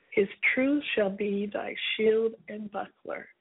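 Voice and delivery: low, solemn Bible reading; scripture reading. Acted. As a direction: read the psalm with reverence. Reads Psalm ninety-one aloud.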